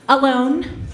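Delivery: usual speaking voice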